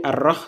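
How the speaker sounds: "Ar-Rah" with a rasped kh at the end; short "Ar-Rah" with throaty kh ending; wrong pronunciation shown. Shown as a demonstration. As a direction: mimic the error, kh in place of h in "Rah". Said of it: "c'est pas bon".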